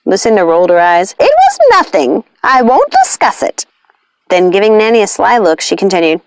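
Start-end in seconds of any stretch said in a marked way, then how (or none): 1.2-3.6 high, dismissive witch voice. A narrator's reading. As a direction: brush the question off. Lucinda rolls her eyes.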